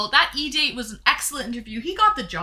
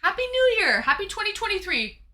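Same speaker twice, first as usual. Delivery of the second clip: high, bright New Year cheer; excited toast; 9 semitones higher than usual. Real, not acted. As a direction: raise the glass and cheer it.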